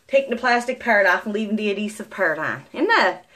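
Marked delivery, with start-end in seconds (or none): none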